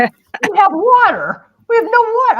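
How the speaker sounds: dismayed outcry. Retold or imitated. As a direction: wail it like the water's really gone. Telling of the water outage.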